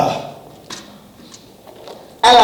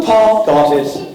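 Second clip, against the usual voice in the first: ordinary talk, nothing marked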